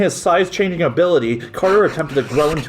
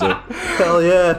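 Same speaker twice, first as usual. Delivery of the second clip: excited cheer; 4 semitones higher than usual. Real, not acted. Cheering Carter's punch.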